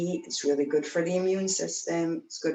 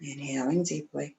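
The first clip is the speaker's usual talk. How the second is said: guided meditation. Acted. Guides breath up the spine.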